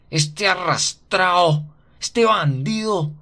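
high, loud, very slow enraged cursing; furious scolding. A narrator's reading. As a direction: spit out each insult in rage. Godmother's fury breaks out.

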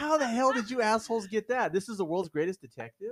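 loud, high-pitched disbelief; incredulous outburst. Real, not acted. Podcaster ribbing the film's world's greatest detective, incredulous. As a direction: blurt it out in exasperated, amused disbelief. Mocks the absurd riddle solutions.

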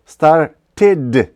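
emphatic "started" with a clear "tid" ending; pronounced E in STARTED. Shown as a demonstration. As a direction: punch out both syllables of "started", E sounded. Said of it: "Вимовляється І".

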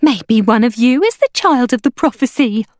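high, loud hawker woman; excited exclamation. A narrator's reading. Hawker woman flattering the children, excited exclamation. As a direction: exclaim it as a thrilling possibility. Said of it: "the porridge woman exclaimed".

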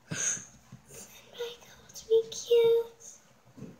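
gasping delight. Acted. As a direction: gasp it out in slow giddy awe. Gasps at compliment.

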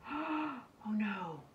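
mother realizing the tickets are gone; gasping. A narrator's reading. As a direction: say it on a shocked breath. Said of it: "Ava's mother gasped".

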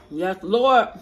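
ordinary talk

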